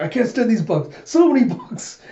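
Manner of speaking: high, loud mock freak-out; hammed-up bug-swatting tantrum; exasperated outburst. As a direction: act out being fed up, climbing to a squeal. Acted outburst while swatting bugs.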